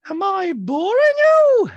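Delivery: very high, loud, stretched-out mock drama; hammed-up shouted impersonation; Dorit. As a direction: caricature her, slow and over-emphatic. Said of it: "And Dorit's like".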